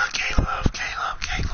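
breathy whispered calling of the name. Real, not acted. Player softly calls teammate Caleb, whisper. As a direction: whisper his name to get his attention.

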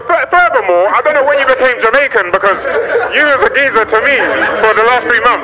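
loud, high comic outrage; indignant rant. Retold or imitated. Accusing the driver of suddenly becoming Jamaican, loud indignation. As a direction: accuse him at full voice. Acted rant about the fake accent.

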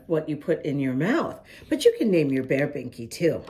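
usual speaking voice

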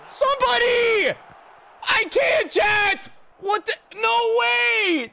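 very high, loud screams of disbelief; desperate shouting. Real, not acted. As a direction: scream helplessly at your players. Panic as opponent attacks.

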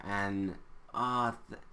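ordinary delivery

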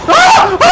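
high, loud shriek then nervous laughter; scream, then laugh it off; panicked scream laugh. Real, not acted.

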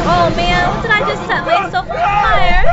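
loud, high alarmed outburst; panicked loud exclamation; shocked yell. Real, not acted. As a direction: cry out in disbelief at your own mistake. Set himself on fire in game.

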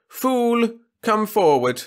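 loud, summoning call. A narrator's reading. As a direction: summon the jester loudly. Trescu calling out.

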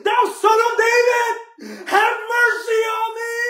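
high, loud desperate scream. Acted. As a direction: scream it over everyone, begging. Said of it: "so I'm gonna scream louder and louder".

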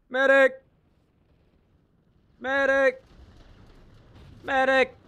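high calls for a medic; man down shout. Pretend. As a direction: holler for the medic. Calling for a revive.

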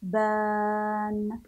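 "ثعبان" stretched slowly, the ث clearly lisped; drawn-out model word. Shown as a demonstration. Teacher models the word for children, slow and drawn out. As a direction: say "ثعبان" slowly, tongue between teeth. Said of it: "ثم".

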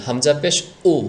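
উ with a crisp glottal catch; knocked hamza উ. Shown as a demonstration. Teacher models it for learners, knocking on উ. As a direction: strike উ from a closed throat. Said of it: "এভাবে বলবেন".

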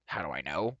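low, incredulous repeat of the question; affronted dwarf, rising at the end; indignant echo. A narrator's reading. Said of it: "snapped Dolly".

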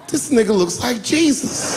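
high, slow, awestruck outburst; hammed-up high-pitched amazement; amazed exclamation. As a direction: exclaim it in comic awe. Amazed audience member watching Obama.

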